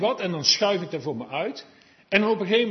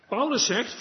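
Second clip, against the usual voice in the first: normal talking voice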